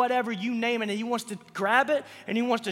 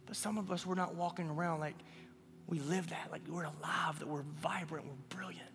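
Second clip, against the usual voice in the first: hushed; 6 semitones lower, 13 dB quieter than usual. Real, not acted. Preacher drops to hushed voice.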